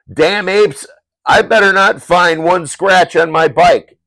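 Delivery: high, loud angry growl at the apes; angry biker. Acted. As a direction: snarl it like a furious biker. Voices biker in comic.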